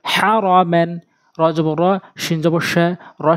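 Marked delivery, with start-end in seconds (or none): 0.0-0.9 "হ্যারমেন" in one short run, vowels not held; quick, unstretched. Shown as a demonstration. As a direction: say "হ্যারমেন" quickly as the correct model. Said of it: "উচ্চারণ করতে হবে দ্রুত".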